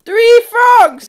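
high, loud exclamation; excited yell. Real, not acted. Gamer finding a third frog, excited yell. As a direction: shout it in delighted amazement.

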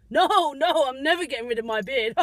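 loud, playful refusal; emphatic mock protest. Driver defending his beard to viewers, loud mock protest.

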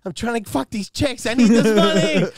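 high, loud excuse; dopey son yelling his reason; mock son yelling. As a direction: shout the excuse back at dad. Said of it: "I'm like".

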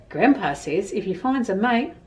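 usual speaking voice

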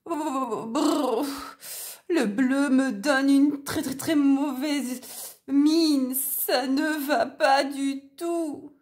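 frozen wolf with chattering teeth. A narrator's reading. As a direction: stutter through the cold. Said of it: "En claquant des dents".

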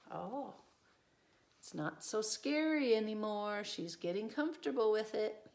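plain reading voice

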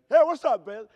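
booming high brash American hello; loud American greeting. Retold or imitated. Comic mimicking loud Americans, brash shouted hello. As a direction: blast it out like a loud Pittsburgh American.

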